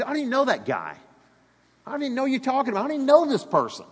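growing defensive denial; heated denial. Retold or imitated. Peter denying, acted in sermon.

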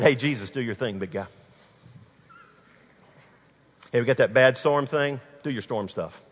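low, casual mock request; breezy, lightly mocking swagger; cocky. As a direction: toss it off like it's routine. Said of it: "There wasn't any cockiness".

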